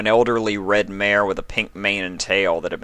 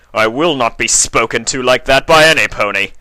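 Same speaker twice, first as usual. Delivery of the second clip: loud, higher menacing threat; growled; 5 semitones higher, 8 dB louder than usual. A narrator's reading. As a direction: threaten her in a fury. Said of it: "he growled at her".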